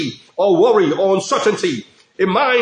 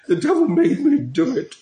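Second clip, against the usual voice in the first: higher, whiny put-on excuse voice; mock excuse; 6 semitones higher than usual. Preacher mocks people blaming the devil, whiny. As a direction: play a guilty person blaming the devil.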